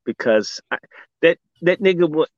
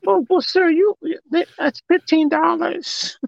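much higher, slow and put-on, like a prissy clerk; mock cashier voice; 13 semitones higher than usual. A cashier telling a welfare shopper the chicken price, in a high put-on voice. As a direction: squeak out the price like a fussy cashier.

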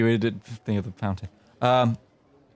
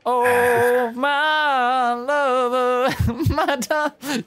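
high, slow swooning lover; lovesick voice demo; 11 semitones higher, much slower than usual. Shown as a demonstration. GM testing a voice for Gavin, high swooning. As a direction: croon it like a lovesick suitor. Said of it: "Just a little lighter".

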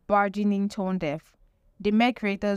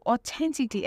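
authentically said higher and pushed; stress on authentically; 4 semitones higher than usual. Shown as a demonstration. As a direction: lean hard on the word authentically. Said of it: "emphasis on the word authentically".

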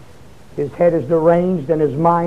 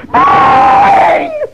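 a sudden, much higher shouted bang; imitated phone ring; 5 dB louder than usual. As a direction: blast out the sound of the ringing phone.

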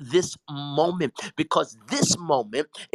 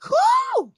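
high whoop; 6 dB louder than usual. Real, not acted. Overwhelmed exclamation.

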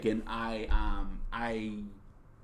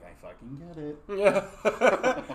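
a slow, drawn-out knowing mom line, half laughing; imitating mom; 3 semitones higher, 4 dB louder, much slower than usual. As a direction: mom at dinner staring at her son, slow and laughing. Mom's look at dinner.

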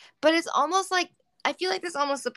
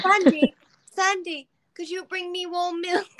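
higher, lightly imitated child calling out; British little boy; 6 semitones higher than usual. Host quoting the kid asking Sandy for milk, British little boy. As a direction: call for a helper like a small British kid. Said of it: "In this accent".

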